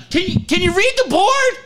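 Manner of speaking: high, pressing question; exasperated plea. Retold or imitated. Screener asking Ted to read the board, high exasperated plea. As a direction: plead with the host. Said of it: "I look at him".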